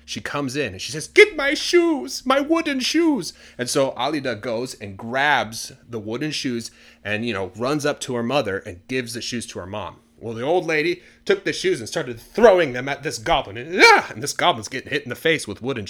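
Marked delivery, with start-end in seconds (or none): 1.1-3.3 high, loud, drawn-out cry for help; hammed-up screaming old lady; frantic shout. Acted frightened mother shouting orders. 13.8-14.1 loud, high yell while throwing; acted shout of exertion; effort yell. Old lady hurling shoes at goblin.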